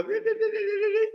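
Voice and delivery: very high, loud babbled beeping; silly baby-amusing noise. Retold or imitated. Adult trying to get a baby to smile, silly high beeping. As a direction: make a squeaky, ridiculous noise for a baby. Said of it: "You walk a baby, you go".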